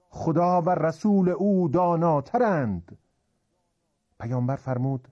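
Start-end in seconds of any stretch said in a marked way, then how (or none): 0.1-3.0 slow, high, loud collective reply; crowd answering in unison. Acted. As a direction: proclaim the answer as one crowd. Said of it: "جمعیت یه صدا جواب دادن".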